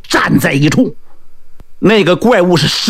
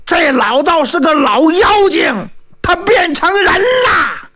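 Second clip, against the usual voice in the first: loud shout; 9 semitones higher than usual. Acted. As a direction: shout at him to attack now. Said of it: "张信大叫".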